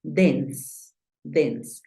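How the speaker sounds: dense with a plain Spanish E, one syllable. Shown as a demonstration. Teacher models dense to the student like Spanish. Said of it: "igual que en español".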